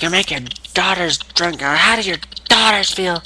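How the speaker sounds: high-pitched mock taunt; imitated accusation. Acting Punk's taunt of Austin.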